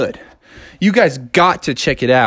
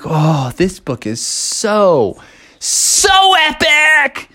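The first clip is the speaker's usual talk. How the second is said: excited; 5 semitones higher, much slower than usual. Retold or imitated. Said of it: "and he was like".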